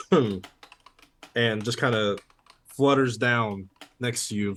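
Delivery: normal talking voice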